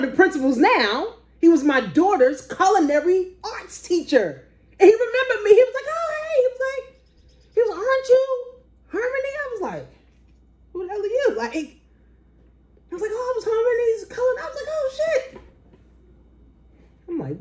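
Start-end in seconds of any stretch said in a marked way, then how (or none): 4.8-15.4 high, loud, teary squeals; overjoyed at being remembered; squealing excitement. Retold or imitated. The old teacher remembering her, high teary squeals.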